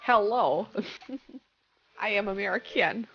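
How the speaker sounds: fake American accent. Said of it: "You gotta be like".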